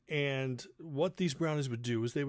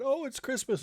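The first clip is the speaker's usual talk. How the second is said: much higher, sing-song excited mom; Phyllis gushing; 12 semitones higher than usual. Retold or imitated. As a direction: mom swooning over Christmas. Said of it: "my dad".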